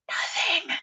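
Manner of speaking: "Nothing" breathed out in a soft whisper; hushed, unvoiced "Nothing"; whispered repeat. Real, not acted. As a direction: whisper the word "Nothing" for dramatic effect. Repeated word, drops to whisper.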